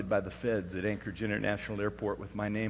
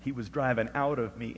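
ordinary delivery

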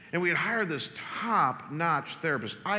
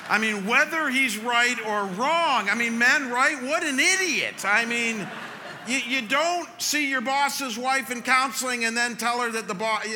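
mock outrage; 8 semitones higher, 6 dB louder than usual. Real, not acted.

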